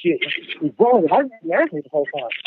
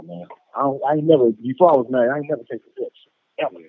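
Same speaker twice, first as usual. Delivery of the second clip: ordinary talk, nothing marked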